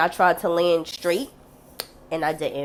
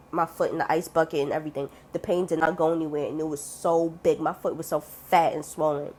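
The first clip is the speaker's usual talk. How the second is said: ordinary talk, nothing marked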